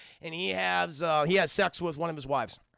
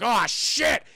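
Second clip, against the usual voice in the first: loud, raised cry of alarm; mock alarmed outburst; 4 semitones higher, 8 dB louder than usual. Cyclops discovering the seer's corpse, mock alarmed shout. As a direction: blurt it out loud in mock panic.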